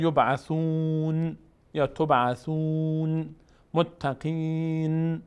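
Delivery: long chanted vowels in یبعثون، تبعثون، متقین before a still n; still final nun. Shown as a demonstration. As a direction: hold the last syllable level in یبعثون and متقین.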